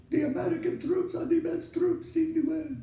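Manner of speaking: put-on Russian accent. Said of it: "in her Russian accent".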